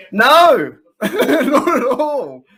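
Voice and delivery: loud, high burst breaking into laughter; laughing refusal. Real, not acted. Streamer answering whether Vegemite is good, laughing loudly. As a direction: blurt the denial, then stretch it out through laughter. Laughing rejection of a viewer's question.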